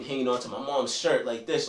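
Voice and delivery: ordinary talk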